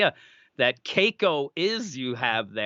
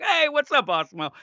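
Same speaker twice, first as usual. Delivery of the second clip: cheery humoring greeting; 11 semitones higher than usual. Pretend. Humoring kid who claims robot.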